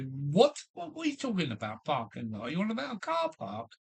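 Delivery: high, fast and baffled; mock disbelief at a strange word; incredulous retort. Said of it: "I say".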